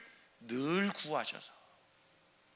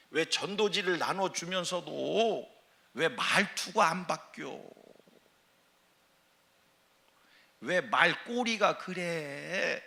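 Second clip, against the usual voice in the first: slow reproach; slower than usual. Acted. Preacher mimics scolding question.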